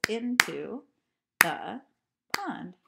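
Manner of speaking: into, the, pond beaten out loud and separate; clapped syllables. Shown as a demonstration. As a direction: hit every syllable like a clap. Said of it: "into has two syllables".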